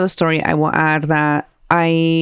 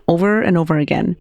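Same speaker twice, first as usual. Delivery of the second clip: plain reading voice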